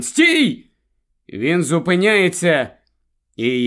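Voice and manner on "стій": high, urgent cry to halt; shouted warning. A narrator's reading. Wife stopping her husband at the wheel, shouting. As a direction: call out sharply to stop the driver. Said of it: "кричить Корін".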